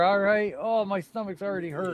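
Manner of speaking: ordinary talk, nothing marked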